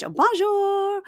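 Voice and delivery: high, sung-out Bonjour; French bonjour. Shown as a demonstration. Showing her two French words, high Bonjour. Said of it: "I only speak two words in French".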